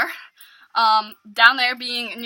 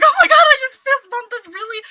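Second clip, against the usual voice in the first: high, loud giddy gushing; freaking out inside; 11 semitones higher, 7 dB louder than usual. Retold or imitated. Girl after fist bumping the pretty guy, freaking out inside. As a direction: gush as if barely containing the thrill. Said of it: "freaking out on the inside".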